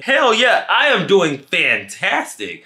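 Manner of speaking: high, loud, exuberant answer; emphatic delight. Acted. Storyteller answering the date's worried check-in, exuberantly delighted. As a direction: punch it out high, stretching the last words.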